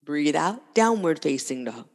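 ordinary talk, nothing marked

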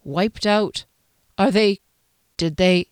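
hesitant fragments with gaps between; halting. A narrator's reading. Rider asking the shopkeeper about the lost family, halting. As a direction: break off, unable to finish. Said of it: "stopped as if unable to speak".

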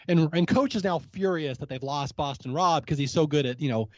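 normal talking voice

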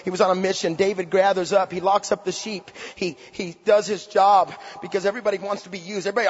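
normal talking voice

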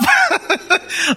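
high-pitched burst of laughter; real laugh. Real, not acted. Preacher laughing mid-sermon.

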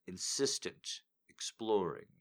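plain reading voice

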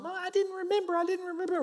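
mock crying child, very high; high-pitched pleading kid, imitated; crying little girl. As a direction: imitate a small sister wailing her excuse. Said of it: "And my sister's crying".

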